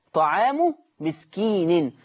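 طعام مسكين drawn out slowly in Quran recitation; slow recited reading. Shown as a demonstration. Teacher slowly reciting طعام مسكين for students. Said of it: "عندنا كلمة فدية".

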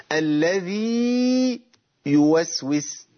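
الذي with the ي held very long, then slow يوسوس; slow Quranic recitation; recited madd. Shown as a demonstration. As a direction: draw out the ي of الذي fully. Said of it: "هات لنا مثال للياء".

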